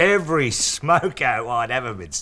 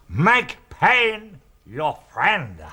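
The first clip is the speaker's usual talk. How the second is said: very slow, higher mock master's line; smiling old master; 5 semitones higher, 6 dB louder, much slower than usual. Storyteller giving the ad's punchline, slow old master voice. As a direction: draw out each word like a sage. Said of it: "he smiles and he says".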